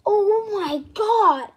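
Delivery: loud, slow, crying oh my God; shocked cry. Acted. Toy realizes it forgot, loud shocked cry. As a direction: wail it out in shock. Realizes April Fool's Day.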